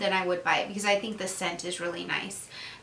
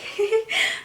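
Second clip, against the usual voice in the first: a bright, high burst of laughter; high giggle; 14 semitones higher than usual. Real, not acted. Laughing at herself mid review, high giggle. Laughing at her own side note.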